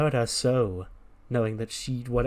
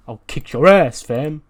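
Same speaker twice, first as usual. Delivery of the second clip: loud, higher mock threat; angry threat; 4 semitones higher, 10 dB louder than usual. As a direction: throw it back forcefully while walking off. Acted threat as he walks off.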